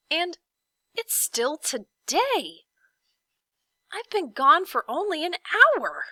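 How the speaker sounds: rising, slowed amazement breaking into a loud high exclaim; amazed cry. A narrator's reading. Milo amazed he was gone only an hour, crying out. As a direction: cry out in wonder at the clock. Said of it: "he cried in amazement".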